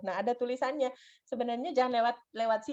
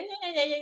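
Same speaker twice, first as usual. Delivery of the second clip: high, sing-song nye-nye babble; light mock of a nagging mum, pitched up; 7 semitones higher, faster than usual. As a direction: parody a mother's scolding. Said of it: "kan ibu-ibu kalau marah".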